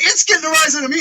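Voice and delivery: retold exasperated comeback; mock indignation. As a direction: throw the line back in a squeaky outraged tone.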